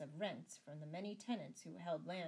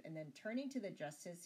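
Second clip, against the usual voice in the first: usual speaking voice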